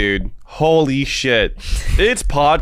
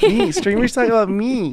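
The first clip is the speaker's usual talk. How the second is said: mock excited fan; 11 semitones higher than usual. Mock fan voice, self-centered.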